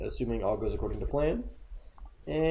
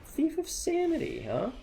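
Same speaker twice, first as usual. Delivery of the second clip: surprised. Real, not acted. Opponent plays an unexpected card.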